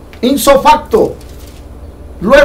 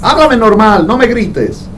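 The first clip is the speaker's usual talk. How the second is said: raised-pitch fatherly voice, lightly voiced; God speaking; 6 semitones higher than usual. God tells a child who shouts to talk normally. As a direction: gently chide a fearful child. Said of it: "y dice".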